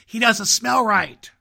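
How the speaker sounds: loud, high, slow exclaim. A narrator's reading. Wild rabbit exclaiming at the Velveteen Rabbit, loud and high. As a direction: recoil in disgust. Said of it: "he exclaimed".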